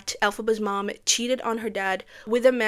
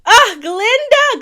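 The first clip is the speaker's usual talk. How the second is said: high, loud, drawn-out exclamation; mock adoring cry; 12 semitones higher, 13 dB louder, much slower than usual. Fan greeting Glinda's entrance, loud adoring cry. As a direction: cry the name out, long and high.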